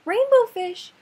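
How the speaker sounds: high loud call. Acted. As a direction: call after him loudly. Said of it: "he called".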